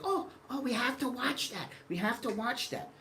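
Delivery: very high, eager squeal; imitating the wife's high excitement; excited wife. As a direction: beg like a thrilled wife who must watch. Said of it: "my wife was like".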